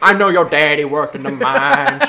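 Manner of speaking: loud, slow, high Texas holler, laughing; Southern Texas accent. Retold or imitated. Co-host mocking the movie boy, Southern Texas accent. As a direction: ham up the Southern twang. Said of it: "Southern Texas boy".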